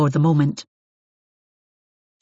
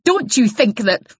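indignant interruption; 6 semitones higher, 4 dB louder, slower than usual. Acted. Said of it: "Isabel interrupted".

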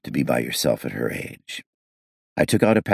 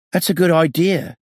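suddenly brighter, much higher; perking up; 14 semitones higher, 4 dB louder than usual. A narrator's reading. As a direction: lift right up with new eagerness. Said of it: "perking up".

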